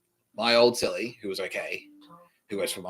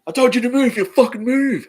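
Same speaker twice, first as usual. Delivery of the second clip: high, loud furious yell; mock-furious shouting at me; angry yell; 10 dB louder than usual. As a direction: bark the order in his face. Inmate yelling in his face.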